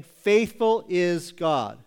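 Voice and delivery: "Faithful is God" slowly, with weight on "Faithful"; slow, deliberate stress on "Faithful" in "Faithful is God"; emphatic, slow. Shown as a demonstration. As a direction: lean on "Faithful", then let "is God" fall. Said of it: "He makes an emphatic statement".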